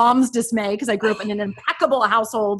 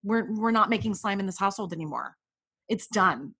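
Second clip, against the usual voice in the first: firm quiet; 2 semitones lower, 7 dB quieter than usual. Retold or imitated. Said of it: "I finally was like".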